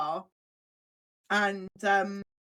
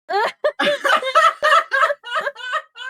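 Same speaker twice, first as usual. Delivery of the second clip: helpless high-pitched laughter, much louder; 8 dB louder than usual. Real, not acted. Laughing at her own story to her friends, loud high laugh.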